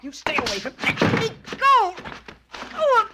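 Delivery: high, loud frightened cries; a woman panics as he seizes her; panicked scream. Acted. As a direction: scream to be let go. Helen struggles as Dan grabs her.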